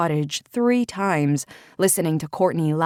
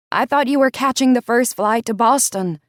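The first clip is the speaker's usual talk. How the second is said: raised, higher shout; 7 semitones higher, 6 dB louder than usual. Acted. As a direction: shout it through the darkness. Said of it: "she shouted through the darkness".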